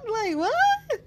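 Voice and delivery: high, incredulous mock what; mock disbelief. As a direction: play people gasping at the news. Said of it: "people like".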